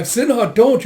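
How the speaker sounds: high, loud cry of warning; urgent warning. Acted. Companion cries out to stop Sinha, urgent shout. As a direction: call out to stop them, pitched high.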